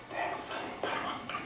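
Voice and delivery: put-on whispered child's reply; a little girl whispering to her pastor. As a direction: breathe it like a shy kid. Child answering pastor softly.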